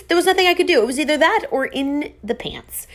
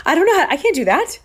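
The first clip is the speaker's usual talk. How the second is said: higher and louder, rushed, flustered refusal; mock panic; 7 semitones higher, 5 dB louder, faster than usual. Refusing her boyfriend's idea, a higher, louder rushed mock panic. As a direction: blurt it out in a fast flustered rush.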